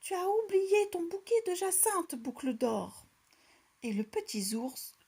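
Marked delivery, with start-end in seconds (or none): 0.0-3.1 higher and louder call after her; middle bear calling out. A narrator's reading. Middle bear calls after Goldilocks, higher and louder. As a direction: shout the reminder across the yard. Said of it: "de sa moyenne voix".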